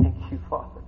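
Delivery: whispered, humble thanks; hushed prayer. Pretend. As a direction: breathe the thanks softly at His feet. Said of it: "You're gonna say".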